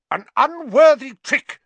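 high, loud, indignant shout; furious shout. A narrator's reading. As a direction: scold them at full volume. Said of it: "she was shouting".

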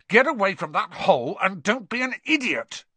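high-pitched angry order; exploding in anger. A narrator's reading. Ron exploding at Neville, angry order. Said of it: "Ron exploded".

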